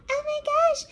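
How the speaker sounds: high gushing busybody voice; nosy neighborhood lady. Retold or imitated. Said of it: "the freaking neighborhood lady".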